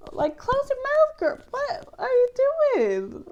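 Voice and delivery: high, laughing scold; laughing exasperation. Real, not acted. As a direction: scold her through a laugh. Scolding the film heroine.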